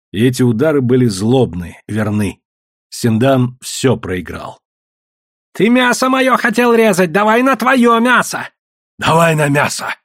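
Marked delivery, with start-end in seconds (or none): 5.5-9.9 high, despairing challenge; hoarse desperate cry. A narrator's reading. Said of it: "хрипло с отчаянием вскрикнул Синдан".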